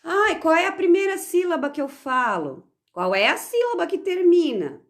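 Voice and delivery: pergunta à turma. Retold or imitated. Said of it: "Aí eu pergunto pra eles".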